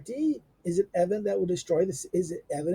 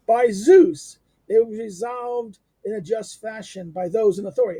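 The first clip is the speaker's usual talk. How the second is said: loud exclaimed oath, then firm declaration; bursting out with the Democrat's defiant reply; Democrat's retort; 2 semitones higher, 7 dB louder than usual. Retold or imitated. Lecturer voicing the Democrat answering the oligarch, loud and indignant. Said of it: "the Democrat, responding to that".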